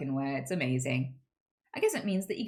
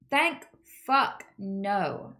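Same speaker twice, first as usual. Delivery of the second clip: ordinary talk, nothing marked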